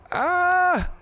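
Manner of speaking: sudden sharp high yelp; startled high yelp. Real, not acted. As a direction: yelp out in surprise. Sudden yelp while raiding.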